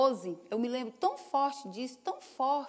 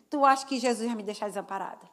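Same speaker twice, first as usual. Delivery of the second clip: ordinary talk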